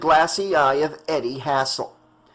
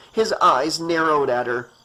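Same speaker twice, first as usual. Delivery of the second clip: plain reading voice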